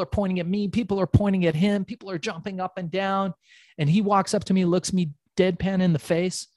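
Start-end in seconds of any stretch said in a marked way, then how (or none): none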